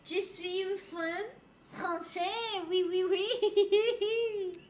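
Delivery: put-on slow, sing-song French accent; hammed-up Parisian lilt, drawn out; exaggerated French accent, slow and sung; mock French. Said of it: "She's so French".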